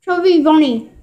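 talking through stuffed cheeks; mouth full. Real, not acted. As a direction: say it round a mouthful of marshmallows. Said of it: "we're each gonna have a marshmallow in our mouth".

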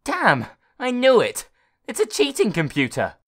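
mock-triumphant kid shout; triumphant boy. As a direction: crow that he caught it out.

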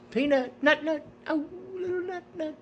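very high, sing-song cooing; high pet-talk coo. Real, not acted. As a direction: coo at the little dog in a squeaky pet voice. Cooing at the dog.

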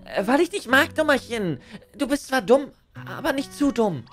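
put-on high, bright cutesy girl voice; chirpy anime girl teasing; cute anime streamer. Voices cheerful game girl.

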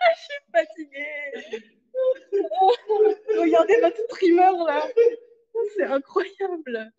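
tearful laughing, worn out; crying with laughter. Real, not acted. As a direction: laugh till you cry. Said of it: "Oh putain, je pleure".

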